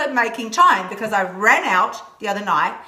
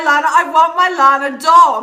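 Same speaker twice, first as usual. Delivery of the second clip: louder, higher gushing; excited; 4 semitones higher, 8 dB louder than usual. Real, not acted. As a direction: squeal over the idea of a doll. Excited about viewer's comment.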